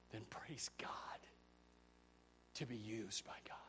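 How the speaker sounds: hushed reverent whisper; whispered praise. Real, not acted. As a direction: breathe the praise softly. Hushed praise after building list.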